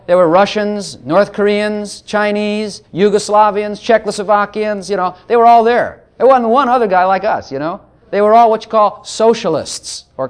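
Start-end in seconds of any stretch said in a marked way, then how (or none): none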